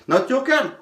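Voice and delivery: high, puzzled showman line; mock puzzled patter. Shown as a demonstration. Magician pretending the card is lost, high mock puzzlement. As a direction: play at being confused. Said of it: "as if you're looking for your card".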